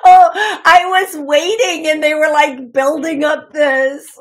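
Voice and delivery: high, loud, laughing retelling. Real, not acted. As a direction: tell it through a burst of giggles. Giggling over the song.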